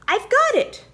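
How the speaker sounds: high, loud excited shout; owl shout. A narrator's reading. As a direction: burst out with the idea. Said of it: "Spotty Owl shouted".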